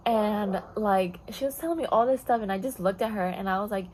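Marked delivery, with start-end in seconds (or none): none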